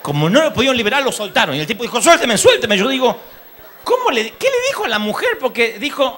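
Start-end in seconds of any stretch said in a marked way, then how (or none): 2.0-2.8 high, louder laughing plea; begging to be let go. Retold or imitated. Neighbor pleading to be freed. 3.8-5.4 very high, laughing question; can't believe what he'll tell his wife; laughing disbelief. Retold or imitated. Child laughing at neighbor.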